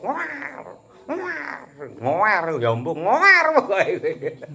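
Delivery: high-pitched newborn "ngoar" cries, stretched out slowly; imitated baby wail. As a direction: mimic a newborn bawling. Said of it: "Dibopong wis nangis".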